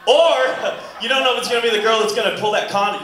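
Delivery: ordinary talk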